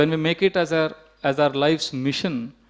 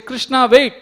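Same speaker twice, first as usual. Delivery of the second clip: loud, high call to stop; acted-out urgent shout; 10 semitones higher, 10 dB louder, slower than usual. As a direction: imitate a mother halting her departing son. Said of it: "again Mother Yashoda would turn back".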